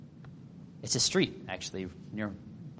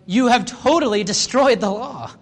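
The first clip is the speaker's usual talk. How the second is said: slow, higher, louder accusation; mock stern rebuke; 7 semitones higher, 5 dB louder than usual. Jesus rebuking Pharisees, slow and louder. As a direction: lean into it like a rebuke.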